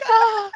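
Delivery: high, loud exasperated "ah"; frustrated cry. Retold or imitated. Listener overwhelmed by background noise on the call, mock wail. Retold reaction to noisy call.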